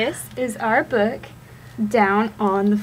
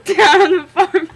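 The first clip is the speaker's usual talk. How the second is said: giggling through the words; laughing; 6 semitones higher, 8 dB louder, faster than usual. Real, not acted. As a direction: laugh while reading.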